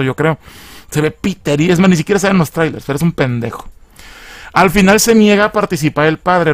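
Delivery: ordinary delivery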